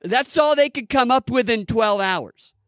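high-pitched incredulous outburst; mock disbelief. Real, not acted. Host reacts to twelve hours of preparation, incredulous. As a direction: scoff at it in a high, amazed voice.

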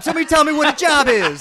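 high-pitched plea to the audience; hammed-up student host; mock improv performer. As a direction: parody an improv kid begging for a suggestion. Mocking improv troupe asking for suggestions.

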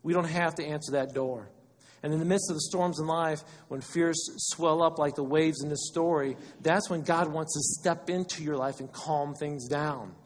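usual speaking voice

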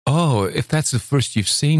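put-on girl delighted by a flower; excited cry. A narrator's reading. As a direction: burst out with childlike delight. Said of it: "she cried".

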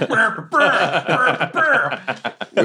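lips blown like a farting trumpet; mock trumpet blats, laughing; trumpet fart noise. Player mimicking her trumpet during the fight, laughing blats. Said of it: "I'm still farting that trumpet".

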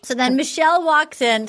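normal talking voice

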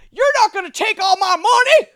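high, loud hammed-up Southern yokel; mock Southern accent. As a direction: shout it like an outraged Southern Republican. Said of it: "to give it a Southern accent".

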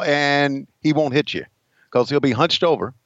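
ordinary talk, nothing marked

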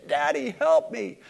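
put-on high-pitched pleading child; small high voice calling to daddy; child calling for help. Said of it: "any child that would come to a parent".

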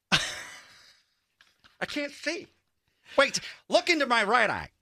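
high, strained showman impression; hammed-up high celebrity voice. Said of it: "Is that [name]".

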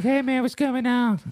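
high, chill dog voice; dog's voice. Pretend. Speaking for his dog Tony, high laid-back dude voice. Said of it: "And Tony's like".

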